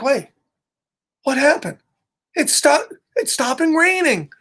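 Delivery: high-pitched, puzzled wonder; surprised Piggy. Acted. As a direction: say it as a pig amazed by a sudden change. Piggy notices the rain stopping.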